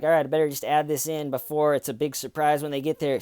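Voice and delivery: fast inner-thought imitation; a suspect hurrying to get her confession out, fast; quick voice. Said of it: "in that quick voice".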